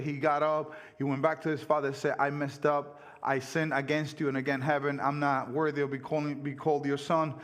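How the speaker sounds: normal talking voice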